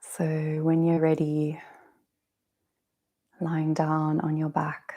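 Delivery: slow, calm meditation guiding with long pauses; guided meditation. Real, not acted. Yoga teacher settling listeners lying on their backs, guided meditation. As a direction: ease them gently down, phrase by phrase.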